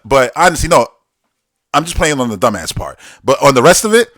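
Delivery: usual speaking voice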